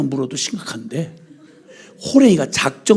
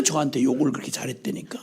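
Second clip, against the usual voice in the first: confiding whisper; 4 semitones lower, 9 dB quieter, faster than usual. Real, not acted. Confiding aside.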